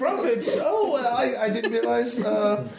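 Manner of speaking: high, hesitant falsetto hostess; flustered mother. Acted. Mother asking the visitor about his faith, high and flustered. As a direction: stammer politely in a very high mother's voice.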